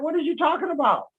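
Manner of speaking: high, disbelieving what-are-you-on-about; incredulous look. Pretend. Brushing off a pushy questioner, mildly incredulous. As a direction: brush it off like nonsense. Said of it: "you look at them like".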